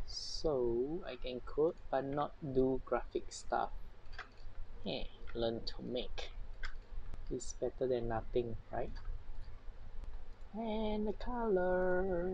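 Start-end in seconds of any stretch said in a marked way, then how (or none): none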